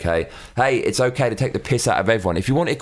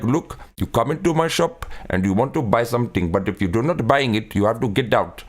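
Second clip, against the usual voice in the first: put-on Indian accent. Comedian parodying an Indian shopkeeper haggling with a customer, Indian accent. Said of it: "the Indian voice. He's like".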